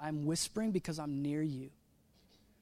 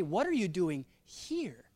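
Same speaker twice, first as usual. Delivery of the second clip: slow question, pause before HERE; pointed, separated HERE; stress on HERE; slower than usual. Shown as a demonstration. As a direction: hold off, then pitch HERE up and let it fall. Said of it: "what if he said it this way".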